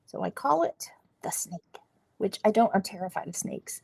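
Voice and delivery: whispered aside; hushed confiding whisper. Real, not acted. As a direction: say it under your breath.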